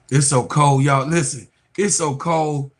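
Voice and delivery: loud, emphatic grumbling about the cold; griping loudly about the freezing weather; complaining loudly. Real, not acted. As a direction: make the cold sound unbearable. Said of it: "It's so cold, my nose stopped up".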